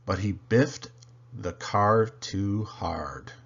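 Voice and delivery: usual speaking voice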